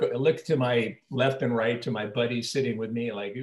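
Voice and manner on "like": ordinary talk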